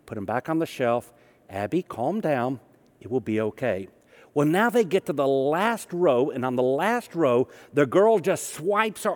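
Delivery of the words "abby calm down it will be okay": steady calm for a tantrum. Retold or imitated. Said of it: "Mom very calmly".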